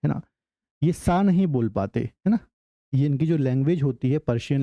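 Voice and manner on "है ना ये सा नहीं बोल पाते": ordinary delivery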